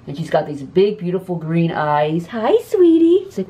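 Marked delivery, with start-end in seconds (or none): 2.3-3.2 much higher, sing-song greeting. Real, not acted. To the cat Babsy, high baby-talk hello.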